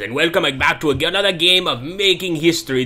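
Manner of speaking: loud put-on African accent. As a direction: greet them in an African accent. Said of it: "I'm losing my African accent".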